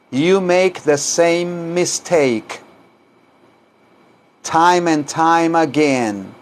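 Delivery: slow, evenly spaced teaching delivery; slow repeat-after-me model. Shown as a demonstration. Teacher leaves room for students to repeat, slow. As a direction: stretch the words so learners can follow.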